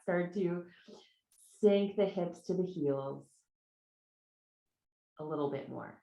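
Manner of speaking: soft, measured breath cues with long pauses; guided breathing. Real, not acted. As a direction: lead them gently through each breath. Guides three deep breaths.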